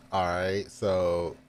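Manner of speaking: ordinary talk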